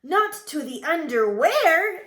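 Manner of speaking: high, loud incredulous question; big astonished kitten cry; shocked exclamation. A narrator's reading. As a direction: exclaim it in comic alarm, rising. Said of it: "exclaimed Skipito".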